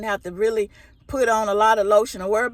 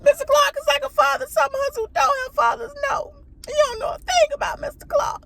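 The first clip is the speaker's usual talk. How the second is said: hammed-up high-pitched crying speech; mock tearful quote. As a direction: wail the movie line as if sobbing, fast at first. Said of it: "I cried on the movie Lean on Me when she said".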